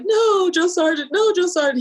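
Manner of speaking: nervous recruit's high-pitched reply to drill sergeant. Retold or imitated. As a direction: squeak it out like a scared recruit.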